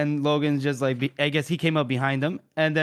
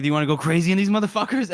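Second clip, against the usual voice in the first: rushed eager offer; much faster than usual. Retold or imitated. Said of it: "So, like".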